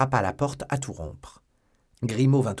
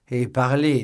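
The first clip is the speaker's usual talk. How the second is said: loud, higher prompt; raised, impatient order; urging command; 4 semitones higher, 10 dB louder, slower than usual. A narrator's reading. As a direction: urge him firmly to speak up. Athos urges friend to speak.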